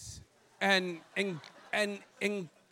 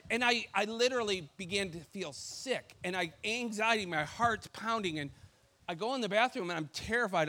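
normal talking voice

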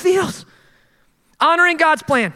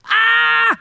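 mock gasp; 10 semitones higher than usual.

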